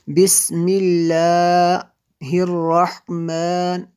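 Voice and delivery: Tajweed chant of बिस्मिल्लाहिर्रहमान with a thin lam; measured Quran recitation, light L in बिस्मिल्लाहिर्रहमान; thin, light articulation. Shown as a demonstration. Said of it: "इसको बारीक पढ़ेंगे".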